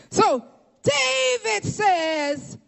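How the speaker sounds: high, drawn-out sermon call; slow, high preaching cadence; preacher's cry. Acted. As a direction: stretch it out like a pulpit proclamation. Preaches at full force.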